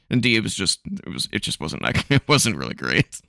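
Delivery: usual speaking voice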